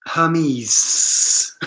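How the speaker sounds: "Hermes" as her-MEEZ with long hissed ending; snake-like final S. Shown as a demonstration. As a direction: stretch the last S of "Hermes" into a hiss. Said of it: "like a snake".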